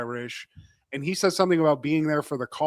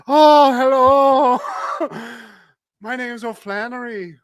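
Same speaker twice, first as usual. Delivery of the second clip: high, loud, hammed-up Irish greeting; mock Irish accent; 7 semitones higher, 7 dB louder, slower than usual. J C showing up at the Irish factory, in a hammed-up Irish accent. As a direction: put on a sing-out Irish hello, pitched way up. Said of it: "He knows how to do an Irish accent".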